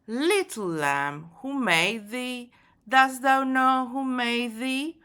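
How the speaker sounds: poem read aloud. Shown as a demonstration. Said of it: "We have to listen to the poem".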